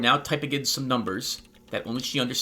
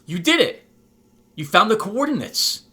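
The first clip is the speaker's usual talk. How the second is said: high, loud congratulation; excited cheer; 7 semitones higher, 7 dB louder than usual. Acted. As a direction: cheer on a friend's success. Cheering the programmer's success.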